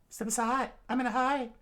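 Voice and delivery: high, cool female voice; high character voice. Acted.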